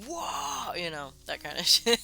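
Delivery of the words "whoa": drawn-out high "whoa", held back in volume; Warhammer orc cry. Shown as a demonstration. Streamer demonstrating Warhammer orcs, mock war cry. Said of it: "with the Warhammer orcs".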